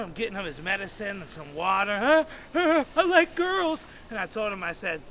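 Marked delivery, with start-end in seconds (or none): 2.0-3.9 high, gulping post-tantrum voice; panting after meltdown. Retold or imitated. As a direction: heave breaths, then blurt it out. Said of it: "And he's calming down".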